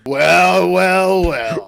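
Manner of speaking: loud drawn-out villain greeting; menacing bully. Acted. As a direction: savour each well like a bully. Bully greets new kids.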